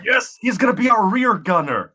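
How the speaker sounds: loud, high-pitched thrilled cheer; real excitement. Real, not acted. Player seizing on the rear gunner plan, thrilled. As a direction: shout it with delight, pitch shooting right up.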